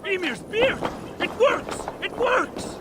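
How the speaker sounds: high, delighted exclamations; excited. Acted. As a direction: burst out in joyful disbelief. Dwarf's repair succeeds.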